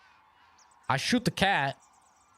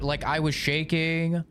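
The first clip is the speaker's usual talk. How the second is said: usual speaking voice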